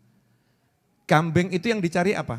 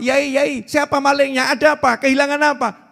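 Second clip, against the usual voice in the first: alarmed villagers; 6 semitones higher, 3 dB louder than usual. Retold or imitated. Villagers rushing to the scholar.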